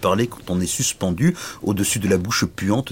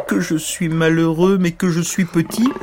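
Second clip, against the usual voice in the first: hammed-up self-pitying moan; 9 semitones higher, 3 dB louder than usual. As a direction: whimper like a sorry little knight. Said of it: "Il gémissait tant et tant".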